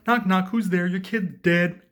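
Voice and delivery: high, loud knock-knock recital; pushed, hammed-up comic voice; blaring joke delivery. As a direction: belt out the bad joke. Joker tells awful joke.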